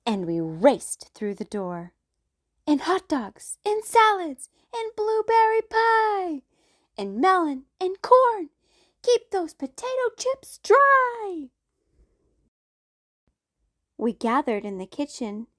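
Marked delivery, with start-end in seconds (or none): 2.6-11.5 high, louder hurried list; frantic mom. Acted. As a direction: rattle off the food in a rush. Mom rushing food inside.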